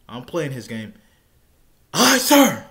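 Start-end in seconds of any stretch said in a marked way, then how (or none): 1.9-2.7 loud, high recruit scream; screamed reply. Retold or imitated. Recruit screaming back at drill instructor, loud high shout. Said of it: "I scream back at him".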